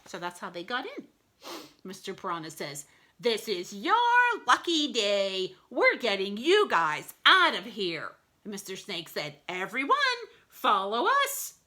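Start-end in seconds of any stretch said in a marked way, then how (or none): 3.2-8.1 lightly voiced piranha, loud proclamation; piranha rescuer. A narrator's reading. Mister Piranha to caged dogs, bold announcement. 9.5-11.5 louder, higher rallying call; rally the group to come along. A narrator's reading. Mister Snake to the caged dogs, rallying call.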